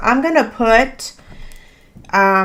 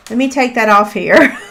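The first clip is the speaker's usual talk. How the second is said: usual speaking voice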